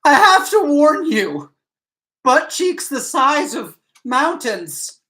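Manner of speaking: high, slow, hammed-up dramatic warning; funny drama thing. [name] hams up a dramatic warning for the card game, high and slow. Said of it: "did a funny drama thing".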